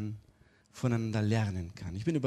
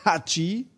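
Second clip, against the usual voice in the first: a quick, higher mock sneeze; 7 semitones higher, 4 dB louder than usual. Shown as a demonstration. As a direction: sneeze it out as a demo. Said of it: "wenn ihr mal niesen solltet".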